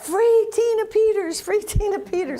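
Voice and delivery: high, loud chant; young inmate yelling a protest chant. Retold or imitated. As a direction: yell it out across the yard as a protest. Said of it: "she would yell out".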